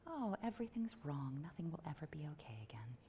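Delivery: calm teacher whisper for bad news; soothing whisper. Shown as a demonstration. Former teacher whispering dire words soothingly to the audience. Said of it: "say the worst things in your heart in that voice".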